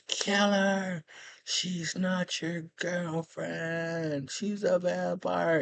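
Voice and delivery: slow high creepy undead command; vampire voice. Retold or imitated. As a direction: draw it out like a sinister vampire. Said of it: "the vampire says".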